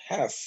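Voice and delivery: "half" with a short open vowel, "haf"; correct "half". Shown as a demonstration. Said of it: "A pronúncia dela é essa".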